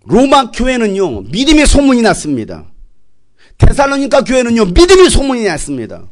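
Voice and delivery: fervent, ringing scripture delivery; full-force preaching. Acted. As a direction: proclaim it to the whole church.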